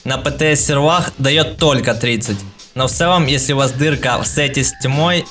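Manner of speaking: usual speaking voice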